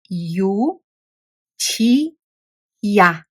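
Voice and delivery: each syllable spelled out separately; slow spelled-out U... T... ya. Shown as a demonstration. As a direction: sound out U, T, ya one at a time with pauses. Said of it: "Now we have a word with three syllables".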